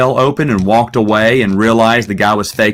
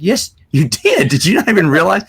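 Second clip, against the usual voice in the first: retold insistence; 7 semitones higher than usual. Retold argument with cussing friend.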